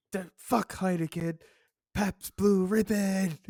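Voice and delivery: high screamed rant, slowed; screaming. Retold or imitated. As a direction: scream it like a madman.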